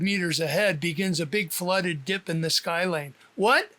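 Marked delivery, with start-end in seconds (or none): 3.4-3.7 sudden high "what?"; surprised. Acted. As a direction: caught off guard. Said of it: "surprised reaction".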